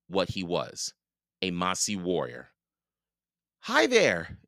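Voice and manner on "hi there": put-on high, small child's greeting. A narrator's reading. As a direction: greet a stranger in a little high voice. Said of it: "said in a small voice".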